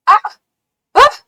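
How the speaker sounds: sudden high loud yelps; startled yelp. Real, not acted. The moth flies at her, sudden startled yelps. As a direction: jump and cry out.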